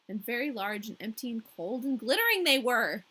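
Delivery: plain reading voice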